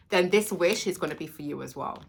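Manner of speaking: ordinary talk, nothing marked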